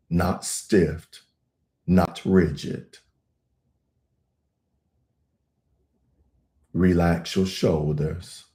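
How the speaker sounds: low, calm meditation voice with long pauses; even, grounded delivery, leaving room to breathe; guided meditation. Acted.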